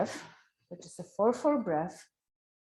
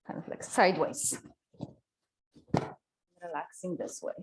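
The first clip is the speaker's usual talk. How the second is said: loud class instructions; 7 dB louder than usual. Real, not acted. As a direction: project instructions across the studio. Projects cues loudly to class.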